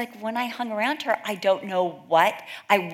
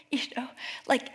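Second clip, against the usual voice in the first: whisper; 5 semitones higher, 5 dB quieter, faster than usual. Real, not acted. Whispered aside about husband.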